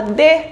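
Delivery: "de" with a rising question tune. Shown as a demonstration. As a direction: ask "de" like a toddler asking "cadê". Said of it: "como se tivesse perguntando". ("de" com entonação de pergunta)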